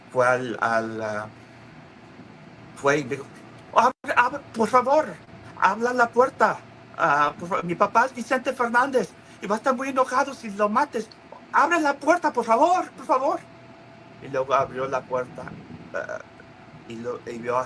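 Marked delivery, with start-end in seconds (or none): 3.8-7.6 desperate begging. Retold or imitated. Gerardo begging the gunman through door, high pleading. 7.6-11.1 much higher frantic plea; high pleading. Retold or imitated. Gerardo at the door, high frantic plea. 11.5-13.4 desperate begging. Retold or imitated. Gerardo begging the gunman through door, high pleading.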